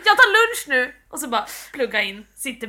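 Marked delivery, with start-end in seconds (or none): 0.1-0.9 high, loud playful announcement; mock cheerful announcement. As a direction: announce your lunch break brightly. Acted quote, cheerful worker.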